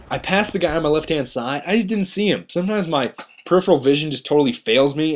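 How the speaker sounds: ordinary talk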